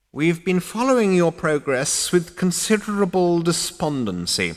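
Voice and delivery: high, reproachful ancient; old ghost voice. Acted. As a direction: drone disapproval.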